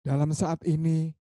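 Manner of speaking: slow meditation voice with drawn-out words; guided meditation. Acted. Teacher guiding friends to feel their bodies, slow guided meditation. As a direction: guide them gradually into body awareness, slow and lifted, with long pauses.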